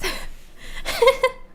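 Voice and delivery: high airy burst of laughter; cracking up at the memory. Real, not acted. Laughing at her own spine story.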